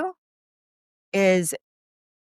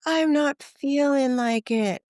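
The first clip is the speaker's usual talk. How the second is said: whiny reluctance; 9 semitones higher, slower than usual. Retold or imitated. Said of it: "it's like".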